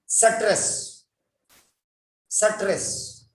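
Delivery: "stress" broken by a vowel, sa-tress; "stress" said as two syllables, suh-tress; mispronounced stress. Shown as a demonstration. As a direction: slip an "uh" between s and t in "stress". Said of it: "There are some people who say".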